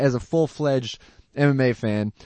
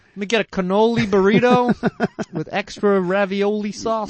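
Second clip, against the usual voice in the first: mock Italian; 8 semitones higher, 3 dB louder than usual. Joking Italian food order.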